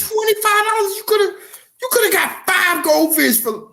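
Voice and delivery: high, incredulous squeal over the price; mock outrage. Husband balking at his wife's pricey fish, high and incredulous. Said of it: "But I was like".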